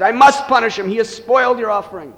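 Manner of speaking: father refusing the Brahmin's plea, angry and loud. Acted. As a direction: scold like a furious father. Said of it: "he became very angry".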